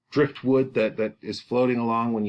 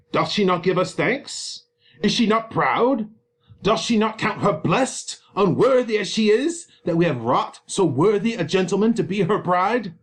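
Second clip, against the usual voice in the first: high, rising rhetorical questions; acted indignation, pitched up; indignant father; 7 semitones higher, 3 dB louder than usual. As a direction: demand it like an affronted father. Capulet indignantly questions his daughter's refusal.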